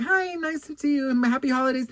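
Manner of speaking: lightly acted high-pitched pleasantry; polite greeting. Customer greeting a perfume saleswoman, high polite hello. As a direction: pitch it up for courtesy. Acting out her polite reply.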